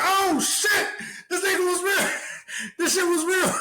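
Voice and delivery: high, panicky laughing disbelief; panicked exclamation. Retold or imitated. Fleeing gunshots, panicked high-pitched disbelief. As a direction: gasp it out while running from gunfire.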